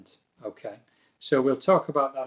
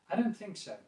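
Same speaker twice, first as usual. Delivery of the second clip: softer, polite disagreement lifted on "don't"; slightly negative; 7 semitones higher, 5 dB quieter than usual. Shown as a demonstration. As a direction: disagree mildly, without aggression. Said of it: "my tone of voice is slightly more negative".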